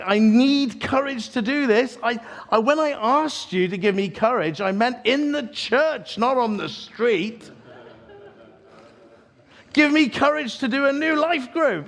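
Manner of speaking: high, loud pleading with God; desperate protest. Retold or imitated. Acted panicked protest to God.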